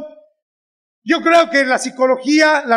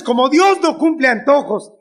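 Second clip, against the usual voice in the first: ordinary talk, nothing marked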